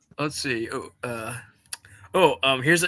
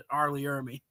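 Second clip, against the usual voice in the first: normal talking voice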